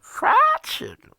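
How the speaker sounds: sudden very high, loud squeal; squealed punchline. Acted. Joking about a drive to the Colonel, with a high squeal.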